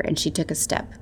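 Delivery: usual speaking voice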